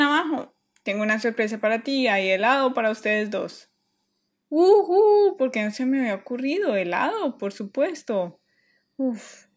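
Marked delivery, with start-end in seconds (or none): 4.5-5.4 a sudden high, loud whoop of joy; excited cheer. Acted. Kid whooping when mom offers ice cream, excited.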